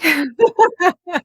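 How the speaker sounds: bright, high laugh of recognition; real laugh. Real, not acted. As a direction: laugh as if caught out. Laughs at the guess.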